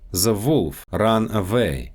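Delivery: Russian accent, "The wolf ran away" as "ze volf ran avay". Shown as a demonstration. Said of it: "W and V sounds".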